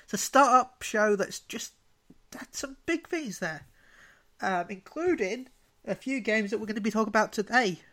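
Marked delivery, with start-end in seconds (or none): none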